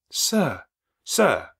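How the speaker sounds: high, loud call; urgent interruption. Acted. Clergyman interrupts Rochester, high urgent call. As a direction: cut in urgently.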